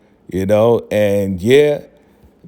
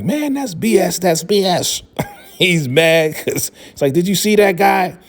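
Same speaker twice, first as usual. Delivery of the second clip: mock outrage; 7 semitones higher than usual. [name] protesting a foul call.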